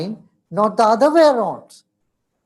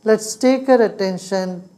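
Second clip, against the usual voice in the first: guided meditation; 3 semitones lower than usual. Real, not acted.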